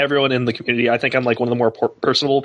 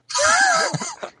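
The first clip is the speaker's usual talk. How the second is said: high-pitched burst of laughter; laughing. Real, not acted. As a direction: crack up at your own imitation. Laughs after mimicking larper.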